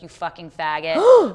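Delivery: slow, loud venomous snarl; imitating hateful threat. Quoting the mother's threat, slow and loud. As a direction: spit it out slowly, full of hate.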